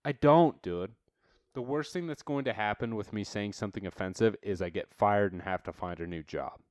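ordinary delivery

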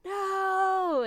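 high, loud, drawn-out shout; Chris Pine screaming. Retold or imitated. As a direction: imitate a movie hero's long held scream. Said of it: "There was a moment where he's screaming".

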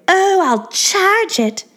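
high, slow moaning starlet voice; drawn-out high moan; mock moan. A narrator's reading. Selling merch to the narrator, a high slow moan. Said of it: "Angeline moaned".

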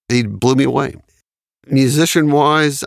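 ordinary talk, nothing marked

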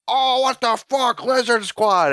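mock gamer outcry. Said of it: "everyone's like".

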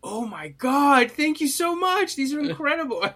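high, drawn-out gasp of gratitude; mock gushing thanks. Friend imitating a character thanking his kidnappers for dolls, gushing.

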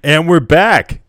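loud, slow, high show-host call; announcer return. Acted. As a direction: boom it out like a radio host back from break.

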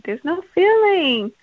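high, slow flat robot voice; slow mock robotic voice; robotic GPS lady. Shown as a demonstration. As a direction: drone it like a machine. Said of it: "so robotic".

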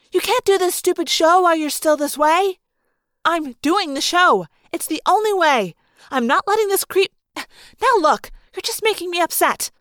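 high, loud quarrel; heated arguing. Acted. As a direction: argue back hotly and insistently. Heated quarrel between two friends.

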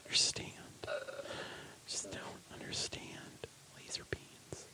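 whispered defeat. Acted. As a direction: mutter it under the breath in despair. Whispered despair.

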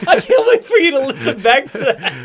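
high, laughing teasing at a friend's rant; laughing hard. Real, not acted. Podcast host cracks up at his friend's sudden fake rage. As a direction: giggle through the words, barely holding it together.

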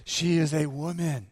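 ordinary talk, nothing marked

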